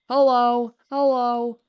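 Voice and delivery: high, loud calling out hello; shouting a greeting across the room. Real, not acted. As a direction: call out to get their attention. Greeting someone in game loudly.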